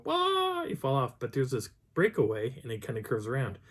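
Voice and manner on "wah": long, very high mock scream; imitated falling cry. Narrator showing a fall over the edge, mock wail. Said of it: "you go".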